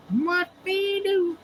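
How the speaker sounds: high, slow kitty singsong; slow cat voice. Pretend. Meowing with the cat for viewers, slow high cat voice. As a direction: drag it out high like a purring cat. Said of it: "meowing it down".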